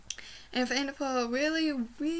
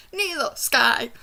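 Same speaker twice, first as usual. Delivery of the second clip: emphatic; 8 dB louder, slower than usual. Real, not acted. Emphatic answer to a question.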